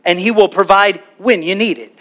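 normal talking voice

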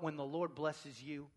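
soft, low aside; understated. Shown as a demonstration. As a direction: say it quietly, holding back. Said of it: "it's okay to get loud".

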